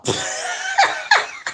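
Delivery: helpless squeaky laughter; high laugh. Real, not acted. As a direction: crack up at your own slip. Laughs at own mispronunciation.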